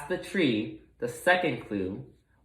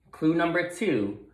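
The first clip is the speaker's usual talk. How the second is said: plain reading voice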